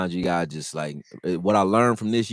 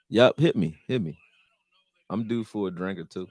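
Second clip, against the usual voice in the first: usual speaking voice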